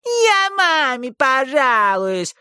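slow, squeaky teasing mimicry; mock the boy in a squeaky voice. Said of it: "пискляво передразнила его Эсси Ли". (писклявое передразнивание)

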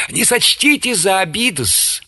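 high, obsequious and reluctant; pained deference. A narrator's reading. Corporal begs the chaplain to accept his rum, painfully deferential. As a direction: plead politely while making a painful sacrifice. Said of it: "как тяжела ему эта жертва".

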